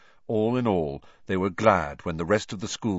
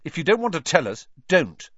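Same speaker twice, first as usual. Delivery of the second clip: loud, high angry snap; snapping; 9 semitones higher, 6 dB louder than usual. A narrator's reading. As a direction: bite the words off angrily. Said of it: "snapped Ron".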